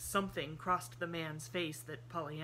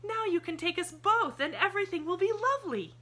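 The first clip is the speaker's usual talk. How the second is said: put-on high child's voice, slowed in happy relief; a little girl sighing with joy; relieved little girl; 11 dB louder than usual. A narrator's reading. Pollyanna's voice, delighted.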